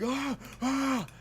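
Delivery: two high-pitched mock screams; retold scream. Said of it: "starts screaming".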